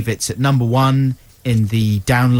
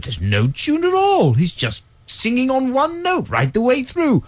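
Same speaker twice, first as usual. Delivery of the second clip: raised, slowed-down mock of a grumpy dad; dad's scornful impression; 12 semitones higher than usual. Daughter imitating her dad dismissing [name], high mocking voice. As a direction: sneer that the song is just one note, pitched high. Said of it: "He did an impression like that".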